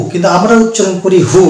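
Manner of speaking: ordinary talk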